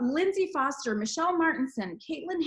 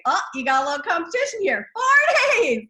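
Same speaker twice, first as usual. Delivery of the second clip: excited; 3 semitones higher, 7 dB louder than usual. Real, not acted. Reacting to four days.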